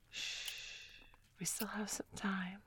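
soft soothing whisper; tender quiet whisper; shushing. Acted. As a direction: hush him gently. Soothing him afterward.

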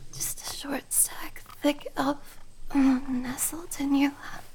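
hushed and flustered; aroused whisper. Acted. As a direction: let it slip out in a whisper. Soft whisper.

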